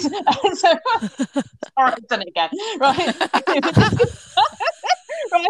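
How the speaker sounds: high giggly laughter through the words; breaking into giggles; laughing. Real, not acted. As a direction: laugh it out, amused at yourself. Laughing at her own story.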